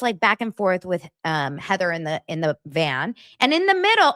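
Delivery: usual speaking voice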